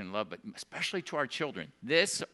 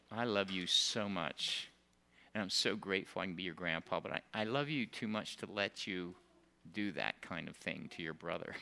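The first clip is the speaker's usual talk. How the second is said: tender grandpa; 7 semitones lower, 8 dB quieter than usual. Retold or imitated. Grandpa holding his crying grandson, soft and tender. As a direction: comfort him while you hold him.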